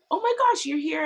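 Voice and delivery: high, squealed oh my gosh, loud; a squeal of delighted surprise; excited surprise. Retold or imitated. Telling a friend how she spotted him on set, squealing in surprise. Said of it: "And I was like".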